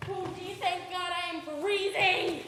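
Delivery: loud, gushing relief; relieved exclamation. Acted. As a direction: burst out with relief. Relief at finding blankets.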